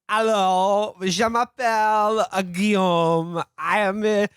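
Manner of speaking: lazy French worker voice; hammed-up French accent. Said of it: "now here's my bit".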